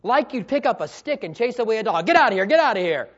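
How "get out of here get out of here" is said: loud, rapid shooing; shooing a dog. Acted. Man with a stick chasing away a dog, shooing. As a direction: fire it off fast to drive a dog away.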